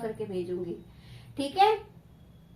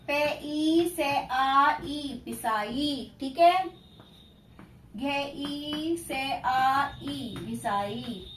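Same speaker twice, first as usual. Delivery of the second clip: slow sing-song syllable spelling; sing-song spelling drill; drawn-out dictation; much slower than usual. Acted. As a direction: stretch each syllable like a tuition teacher dictating.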